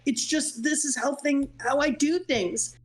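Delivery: ordinary talk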